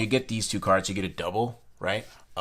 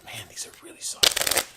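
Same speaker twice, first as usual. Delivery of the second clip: hushed whisper to himself over the cards. Real, not acted. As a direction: breathe it out, almost no voice. Feeling the soft cards up close.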